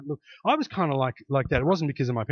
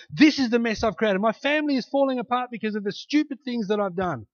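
loud, high, driving sermon climax; fired-up preacher pleading with the crowd; preaching at full force; 7 semitones higher, 5 dB louder than usual. Acted. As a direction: preach it out with rising fervour.

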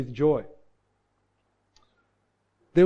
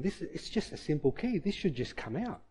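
frustrated mutter; 8 dB quieter than usual. Retold or imitated. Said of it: "And I'm getting angry".

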